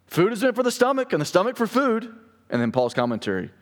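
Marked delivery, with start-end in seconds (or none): 0.0-2.1 high-pitched, breezy slogan of the crowd; mock Corinthian catchphrase in a raised voice; quoting Corinthians. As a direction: voice the audience's saying, pitched well up. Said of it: "Quote here".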